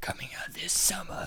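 soft unvoiced trailer whisper. Narrating over a self-made horror trailer, whispered. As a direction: breathe it like a horror teaser. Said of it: "I was gonna be like".

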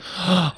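sharp excited gasp; surprised gasp. Retold or imitated. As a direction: gasp in delight. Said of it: "she'll be like".

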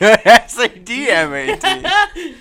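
high, laughing reply. Real, not acted. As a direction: laugh through the letters. Laughing at the name joke.

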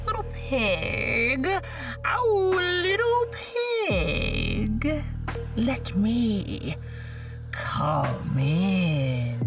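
slow, sing-song coaxing wolf; big bad wolf. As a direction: lure the pig in a crooning voice. Wolf voiced in story.

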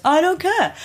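lightly voiced uncaring villain; a hint of a cold, dismissive landlady; mock Christine Baranski. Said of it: "She goes".

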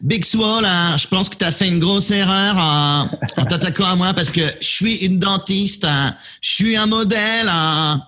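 high, catty diva voice in French; sneering heel diva taunt; bitchy woman voice. Shown as a demonstration. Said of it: "sound like a, you know, cliche, bitchy woman".

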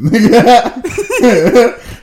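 high burst of laughter; loud laugh. Real, not acted. Big laugh at friend's joke.

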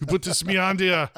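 hammed-up Austrian accent; Arnold impression. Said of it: "I just turned it into Arnold".

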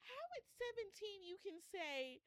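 real emotion nearly choking the words; overcome with tears. Real, not acted. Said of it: "I can't, I can't do this, guys".

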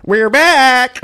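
high, loud show-host call; announcer voice. Pretend.

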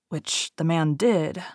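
ordinary delivery

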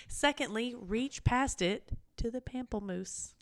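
usual speaking voice

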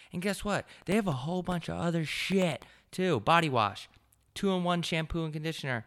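high, punchy hard sell; hyped ad read. Acted. Host urging listeners to buy from the sponsor, hyped hard sell. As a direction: push the product with big energy.

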